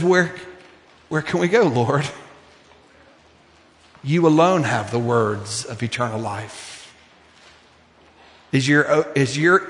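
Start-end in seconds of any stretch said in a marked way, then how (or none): 0.0-6.7 quiet Peter's answer. Retold or imitated. Peter answering Jesus, softly devoted. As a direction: lower and softer, a hushed devoted reply.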